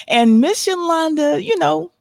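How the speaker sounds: playful teasing. Real, not acted. Teasing nervous guest on air.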